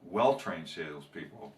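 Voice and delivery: lightly voiced company tagline; ad read. Trainer closes the joke with a company pitch, ad read. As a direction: sign off the commercial, measured.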